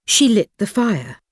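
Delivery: plain reading voice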